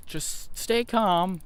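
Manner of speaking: high, slow, drawn-out soothing voice, imitating others; mockingly stretched "stay calm" in a high voice; mock calm advice. People in the panicking crowd urging calm, in a high, slow mock voice. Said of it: "Some people are like".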